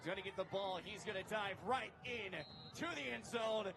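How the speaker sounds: high, shouted touchdown call, racing on the return; play-by-play call. Acted. Host calling a pick return for viewers, shouted play-by-play. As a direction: yell it like the crowd is on its feet.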